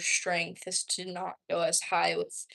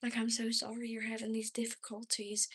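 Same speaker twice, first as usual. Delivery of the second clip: soft, apologetic country accent; quiet Southern customer-service sympathy; 2 semitones higher, 8 dB quieter than usual. Shown as a demonstration. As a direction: lay on a sweet Southern twang for a customer. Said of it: "I switch on that country accent going".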